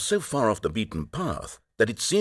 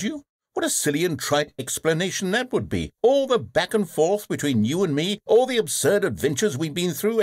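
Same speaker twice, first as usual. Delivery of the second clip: mocking sarcasm; 9 semitones higher than usual. Narrator mocks player's theory.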